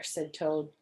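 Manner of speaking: plain reading voice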